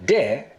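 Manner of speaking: there said as de, with no r; Gullah de. Shown as a demonstration. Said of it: "the word for there".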